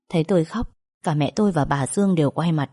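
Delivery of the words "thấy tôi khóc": usual speaking voice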